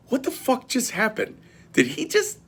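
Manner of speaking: mock-bewildered, as if asking the room; stunned disbelief. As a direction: imitate the guys' hushed, high-pitched shock. Guys react in stunned disbelief.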